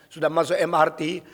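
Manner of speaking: usual speaking voice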